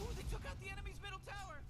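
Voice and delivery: excited. Real, not acted. Excited over game action.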